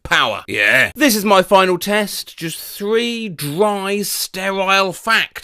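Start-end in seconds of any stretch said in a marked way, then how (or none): 2.4-5.5 drawn-out dramatic pace; slow, deliberate. Acted. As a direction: spell it out slowly. Dramatic slow delivery of final test.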